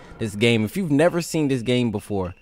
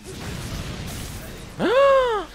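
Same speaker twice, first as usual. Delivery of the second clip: sharp gasp. Real, not acted. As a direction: gasp in shock. Shocked moment in match.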